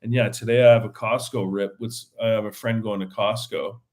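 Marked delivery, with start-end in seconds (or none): none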